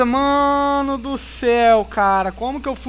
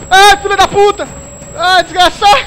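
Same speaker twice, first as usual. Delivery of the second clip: loud high frightened yells; scared horror game streamer; 6 semitones higher, 10 dB louder than usual. Real, not acted. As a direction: yell in real fright.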